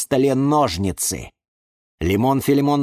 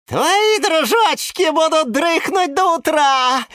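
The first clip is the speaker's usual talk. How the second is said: high, slow malicious taunt; spiteful gloating; 4 dB louder, slower than usual. Acted. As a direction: gloat nastily at the helpless lemon. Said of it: "ехидно сказала Гордения Евгения".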